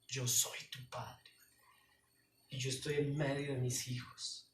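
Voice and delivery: a hint of God speaking gently. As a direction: speak as a tender heavenly father. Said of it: "Y el Señor te dice".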